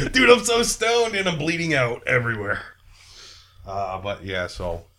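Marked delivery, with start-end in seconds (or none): 0.1-2.6 high, laughing bleeding-out whine; mock stoned victim. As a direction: play a stoned guy dying, hammed up.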